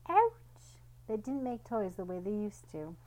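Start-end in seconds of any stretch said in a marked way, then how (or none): 0.1-0.8 sudden high loud yelp; high, loud ouch; yelp of pain. Acted. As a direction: cry out sharply as if bitten. Clifford gets bitten.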